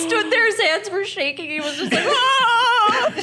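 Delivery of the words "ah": high, loud imitated scream; mock horrified scream. Wife imitating husband with shaking hands, screaming in horror. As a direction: scream like a dad losing his mind. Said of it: "he lost his mind".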